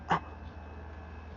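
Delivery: quiet, breathy intake; soft gasp. Retold or imitated. Character reacting inside a retold story, soft gasp. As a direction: draw in a small gasp.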